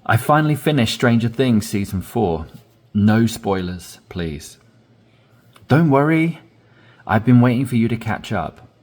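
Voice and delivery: lively conversational reading of a dialogue; natural dialogue read. Shown as a demonstration. Teacher reading a sample chat aloud, lively and natural. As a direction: read both parts like two excited friends chatting. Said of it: "I'm gonna read it without pausing now".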